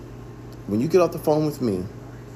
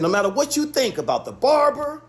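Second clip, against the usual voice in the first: high-pitched, pressing point by point; insistent, high-voiced retelling of an argument; emphatic insistence; 11 semitones higher than usual. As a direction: raise the pitch and drive each point home. Firmly making his point to Trey.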